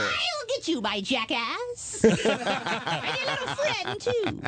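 high, slow cackling witch threat; Wicked Witch voice. Shown as a demonstration. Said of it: "You got the bad witch laugh".

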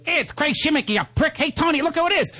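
high-pitched, hammed-up boisterous greeting; Joe Pesci. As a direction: imitate a wise guy making a big fuss. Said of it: "and he goes".

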